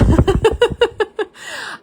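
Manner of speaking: high laughing burst; real burst of laughter. Real, not acted. To chat after a viewer's joke, real laughter. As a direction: laugh out loud at the comment.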